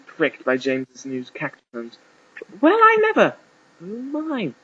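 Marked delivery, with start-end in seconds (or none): 2.6-3.3 very high, loud, scandalized exclamation; surprised mother. A narrator's reading. Groom's mother reacting to the cacturn, shrill surprise. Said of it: "said the surprised James's mother". 3.8-4.5 soft, high-pitched gasp of words; small, high voice of astonishment; surprised mother. A narrator's reading. Groom's mother reacting to the cacturn, surprised. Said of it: "said the surprised James's mother".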